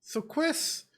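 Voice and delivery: put-on high, soft childlike accent; Ralsei accent. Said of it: "I love the accent in Ralsei".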